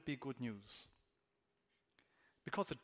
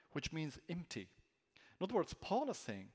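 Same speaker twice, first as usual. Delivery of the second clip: ordinary talk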